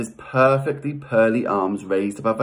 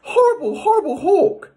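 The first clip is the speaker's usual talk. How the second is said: piercingly high shooing; very high-pitched storybook scolding; Leda's scolding cry. Acted. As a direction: cry out high to chase off a bird. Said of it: "cried Leda".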